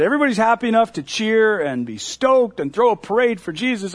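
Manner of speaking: ordinary talk, nothing marked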